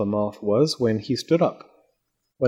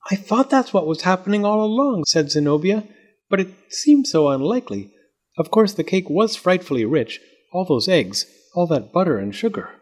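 higher, chatty Zenobia voice; a hint of a prim, knowing girl; lightly voiced knowing Zenobia; 7 semitones higher than usual. A narrator's reading. Zenobia explains to Fletcher why the moth grew, lightly voiced.

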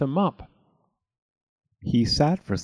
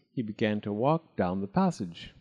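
ordinary delivery

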